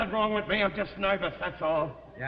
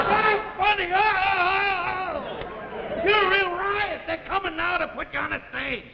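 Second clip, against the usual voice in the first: loud, high mock amusement; sarcastic hilarity; 10 semitones higher, 4 dB louder than usual. Husband mocks wife's wit, shrieking sarcasm. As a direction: howl it as if it were hysterical.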